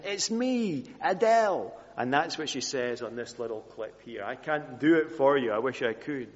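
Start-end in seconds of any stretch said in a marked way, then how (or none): 0.0-1.7 high, light North London accent imitation; chirpy North London girl at the gate, mildly imitated. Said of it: "in her North London accent".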